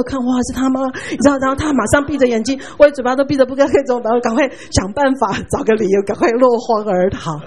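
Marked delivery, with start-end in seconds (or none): none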